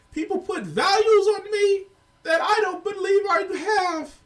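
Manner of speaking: high, loud, whiny Kermit-like impression; mock him in a loud, squealing whine; whiny Jordan Peterson mockery. Commentator mocking a professor's persecution complex, whiny and high. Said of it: "he sounds like a whiny ass Kermit the Frog".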